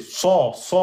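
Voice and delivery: "Sa" dark and backed; repeated emphatic sa. Shown as a demonstration. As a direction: stress the emphatic "Sa". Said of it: "il faut emphatiser".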